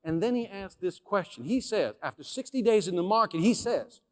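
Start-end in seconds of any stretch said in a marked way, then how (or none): none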